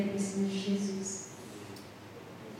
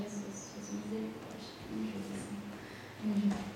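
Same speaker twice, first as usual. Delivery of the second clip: much softer, a pleading prayer under the breath; hushed desperate prayer; 12 dB quieter, faster than usual. Retold or imitated. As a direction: beg Jesus for help almost inaudibly. (oração desesperada sussurrada quase sem voz)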